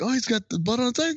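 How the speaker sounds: high, fast mock voice; excited quote. Retelling the movie, quick high character line. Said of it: "he's like".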